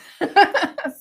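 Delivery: loud, high laugh. Real, not acted. Host admitting she procrastinated on an order, loud laughter. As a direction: burst out laughing at your confession.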